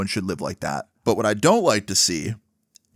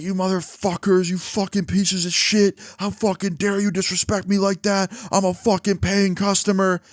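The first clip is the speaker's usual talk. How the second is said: imitated angry screaming; 8 semitones higher than usual. Homeless man screaming about the store, imitated angry rant. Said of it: "what he's screaming about".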